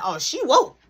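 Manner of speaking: sudden high alarmed realisation; startled that mom woke. Retold or imitated. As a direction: jolt up in pitch, caught off guard.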